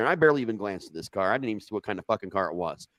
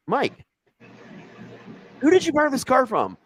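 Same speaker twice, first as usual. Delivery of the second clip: higher, puzzled retelling voice; puzzled question; 5 dB louder than usual. Retold or imitated. Retelling to friends how he questioned Mike, high and puzzled. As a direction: ask it high and baffled.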